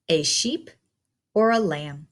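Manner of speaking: ordinary delivery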